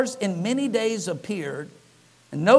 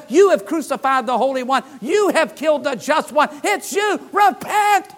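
rising, louder, higher accusing cry; bold preaching shout; 5 semitones higher, 5 dB louder than usual. Retold or imitated. Peter accuses the crowd at Pentecost, building preaching shout. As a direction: hammer it home, building to a high shout.

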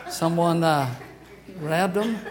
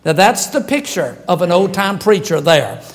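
normal talking voice

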